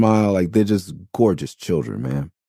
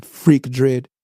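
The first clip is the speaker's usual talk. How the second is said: put-on Jamaican kid taunting; 6 semitones higher, slower than usual. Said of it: "that kid went off".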